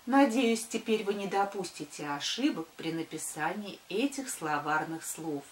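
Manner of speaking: usual speaking voice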